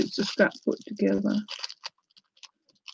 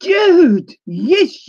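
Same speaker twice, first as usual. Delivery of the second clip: high, loud greeting to Jude; happy shout of a friend's name; excited callout; 10 semitones higher, 8 dB louder than usual. Real, not acted. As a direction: call out Jude's name with delight. Spots a viewer's comment.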